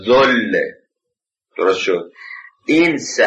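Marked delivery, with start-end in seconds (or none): 0.0-0.6 "ذل" said as "zoll", rounded O vowel; damma on Z. Shown as a demonstration. Teacher showing Z taking an O, "ذل" as zoll.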